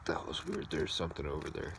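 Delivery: whispered, fast and wary; whispering. Real, not acted. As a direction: hush it, something's out there. Hears a strange noise.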